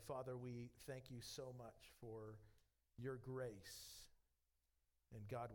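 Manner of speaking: pastor leading the closing prayer, hushed. Real, not acted.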